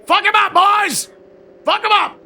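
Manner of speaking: loud hype yell; frat bro. Real, not acted. Hyping the team during race.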